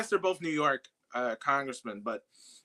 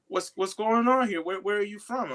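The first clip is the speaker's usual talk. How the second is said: a light take on the congressman's voice, pitched higher; a hint of Bowman's smooth politician voice; imitating Bowman; 6 semitones higher, 3 dB louder than usual. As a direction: mimic the politician's puzzled brush-off, slightly louder. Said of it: "you doing a good imitation".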